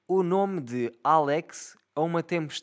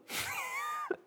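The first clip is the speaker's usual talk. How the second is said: shrill burst of laughter after his own joke; high squealing laugh; 10 dB quieter than usual. Real, not acted. Laughing at his own outrageous insult to the kid, high squeal.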